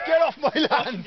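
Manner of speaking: shouting. Pretend. Shouting at people on his land.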